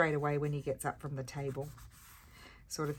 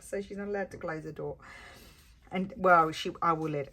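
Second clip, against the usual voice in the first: normal talking voice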